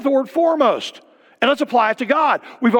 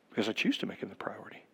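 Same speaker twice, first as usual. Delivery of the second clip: sudden breathy whisper, much softer; 12 semitones lower, 18 dB quieter, much faster than usual. Real, not acted. Pastor whispering his choice to the congregation. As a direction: drop to a whisper to land the point.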